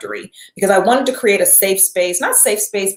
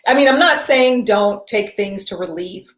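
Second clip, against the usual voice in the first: usual speaking voice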